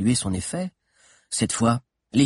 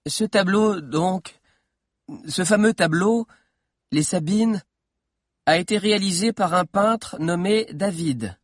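lightly voiced boy, higher pitch; slightly raised, hesitant schoolboy voice; nervous young historian lecturing; 7 semitones higher, 5 dB louder than usual. A narrator's reading. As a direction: start a careful lecture, pausing between phrases. Figure of Valère starting his lecture.

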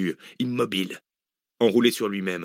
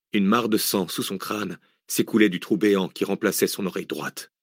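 plain reading voice

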